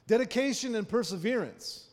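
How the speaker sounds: loud, high proclamation; emphatic preaching. Real, not acted. Preacher urging the congregation to persevere, in a loud proclaiming voice.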